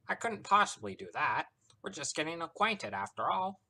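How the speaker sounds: high, smiling trickster voice; placid smugness. A narrator's reading. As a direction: refuse her with airy, teasing politeness. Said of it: "half-lidded stare and placid smile".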